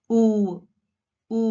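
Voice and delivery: "ఊ" as a long, rounded oo; W lip-rounding demo. Shown as a demonstration. Teacher showing how the mouth starts a W, drawn-out rounded "ఊ". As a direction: draw out "ఊ" with lips pushed forward. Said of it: "like this".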